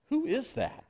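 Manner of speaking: ordinary talk, nothing marked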